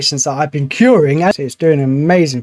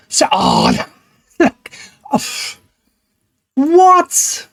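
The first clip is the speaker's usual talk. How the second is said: disbelieving squeal; 10 semitones higher, much slower than usual. Real, not acted. Cure rate drops again.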